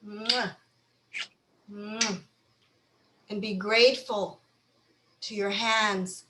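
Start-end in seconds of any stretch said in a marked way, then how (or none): 0.1-0.6 kiss your own hands lightly; gentle smack of a kiss; kiss sound. Pretend. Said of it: "give 'em a kiss". 1.7-2.2 a quieter second kiss; kiss sound. Pretend. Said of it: "give 'em a kiss".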